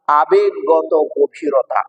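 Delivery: ordinary delivery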